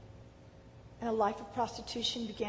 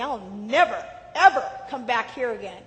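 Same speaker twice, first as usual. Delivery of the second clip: loud, defiant vow with stress on never, ever; proud defiant vow; 7 dB louder than usual. Retold or imitated. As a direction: swear it out of wounded pride. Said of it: "pride arose in me".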